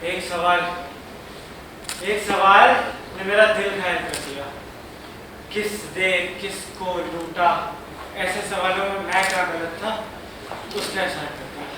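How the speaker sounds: low, hushed hurt; wounded. Acted. Brother wounded by inspector's question, low and hurt. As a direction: speak softly, as if deeply hurt.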